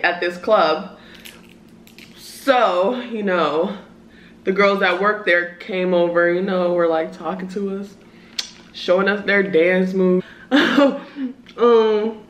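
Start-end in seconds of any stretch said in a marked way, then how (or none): none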